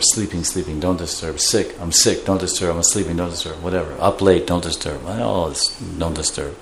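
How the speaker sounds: low, quiet list of excuses; hushed excuses. Acted. People putting signs on doors, mimicked in low quiet voice. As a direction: murmur each excuse low and soft.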